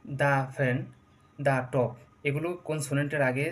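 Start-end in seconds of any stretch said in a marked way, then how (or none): 0.0-0.8 দা ফ্যান with দা as "duh"; short "duh" before the f. Shown as a demonstration. Teacher listing consonant examples, দা as "duh". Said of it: "consonant সাউন্ডের আগে দা উচ্চারণ". 1.4-1.9 দা টপ with দা as "duh"; short "duh" before the t. Shown as a demonstration. Teacher listing consonant examples, দা as "duh". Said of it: "consonant সাউন্ডের আগে দা উচ্চারণ".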